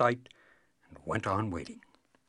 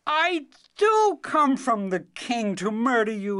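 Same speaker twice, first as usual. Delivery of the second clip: annoyed; 7 semitones higher, 3 dB louder than usual. Acted. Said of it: "The cobbler's son was annoyed".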